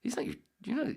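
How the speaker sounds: incredulous coworker. Retold or imitated. Coworker insists, voiced high.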